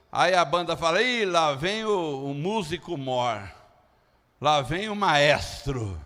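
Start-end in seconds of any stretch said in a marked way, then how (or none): 1.0-6.0 low, drawn-out mock admiration; ironic mockery. Band mocking the drummer who plays all wrong, ironic. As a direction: drag it out drily, dropping the pitch. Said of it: "é uma maneira irônica de zombar".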